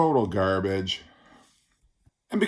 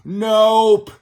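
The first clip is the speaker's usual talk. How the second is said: higher, louder, drawn-out second nope; emphatic mock rejection; 9 semitones higher, 7 dB louder than usual. Reviewer's hope for a sixties sound dashed, comically emphatic. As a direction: cut off the hope, building to a loud cry.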